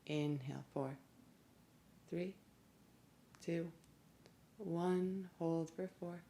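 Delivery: soft, evenly spaced counting with long pauses; guided breathing meditation. Real, not acted. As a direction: count the breath softly and calmly, leaving space after each number.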